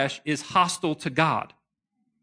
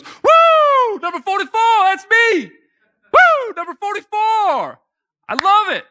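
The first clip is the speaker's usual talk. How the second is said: much higher, much louder ecstatic whooping cheer; Ickey Woods whooping; 8 dB louder, slower than usual. Retold or imitated. Ex-running back hears his deli number and whoops ecstatically. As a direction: scream it like you just won the lottery. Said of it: "Ickey Woods / And he goes".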